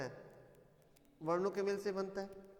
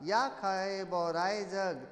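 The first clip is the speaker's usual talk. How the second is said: slow, measured couplet, strongly voiced; verse recitation; 4 dB louder, slower than usual. Shown as a demonstration. Teacher repeating the textbook example, slow verse recitation. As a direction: recite each phrase firmly with long syllables. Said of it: "बहुत सारे उदाहरण देखिए".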